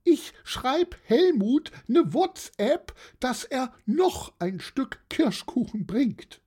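very high-pitched, chirpy aunt; high, obliging auntie voice; jolly old aunt. Acted. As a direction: chatter brightly way up high as the aunt.

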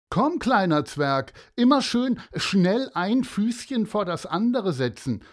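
loud, high schoolyard mockery; mocking bully. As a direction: sneer at him like a cocky bully. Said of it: "sagte Horst oder Jeff zu Amir".

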